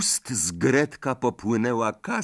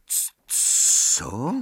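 stammering. A narrator's reading. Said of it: "wyjąkał Harry".

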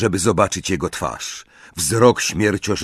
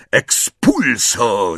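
long drawn-out, raised howl; howled spell; 3 semitones higher, 4 dB louder, much slower than usual. A narrator's reading. Death Eater blasting a table, howling the spell. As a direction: howl the incantation slowly, pitched up. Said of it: "zawył śmierciożerca".

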